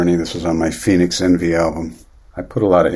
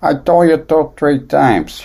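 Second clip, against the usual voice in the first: higher, louder mock Chicago accent, scolding; 7 semitones higher, 4 dB louder than usual. Songwriter imitating the Chicago accent his daughter lacks, Chicago accent. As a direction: put on a thick Chicago accent for a moment. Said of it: "there's different Chicago accents".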